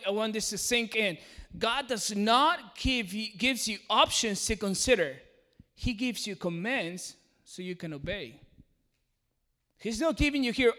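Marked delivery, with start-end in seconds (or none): 1.6-5.2 slow, each word weighted; slow emphatic repeat. Real, not acted. Preacher repeating the key line to the congregation, slow and weighted. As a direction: drive the point home slowly. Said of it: "I'm gonna say that again".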